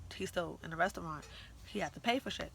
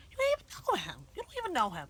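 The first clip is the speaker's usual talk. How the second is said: high, loud disbelief; reenacted outraged objection, pitched right up; indignant protest; 9 semitones higher, 6 dB louder, faster than usual. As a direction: protest to a parent in exasperation. Acted protest to her mother.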